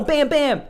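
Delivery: high, lively bam bam; mock gunshot sounds. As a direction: fire off the shots with your voice. Imitating gunshots in the scene.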